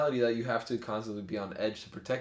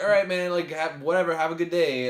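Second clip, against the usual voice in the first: louder and higher, brushing him off; raised placating voice; 6 semitones higher, 7 dB louder than usual. Retold or imitated. Friend retelling brushing off a stranger, raised and placating. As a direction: talk him down while walking away.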